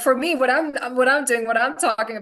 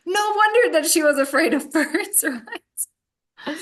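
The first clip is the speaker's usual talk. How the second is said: talking through a laugh, higher; 7 semitones higher, 3 dB louder, slower than usual. Real, not acted. Therapist joking about her client's bird phobia, laughing. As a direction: let laughter break into the words.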